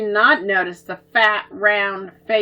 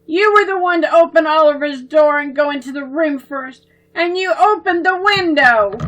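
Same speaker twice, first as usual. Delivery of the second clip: accusing; 6 semitones higher, 7 dB louder than usual. Acted. Debbie blaming Babs for the ruined sketch, accusing. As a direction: blame her sister hard.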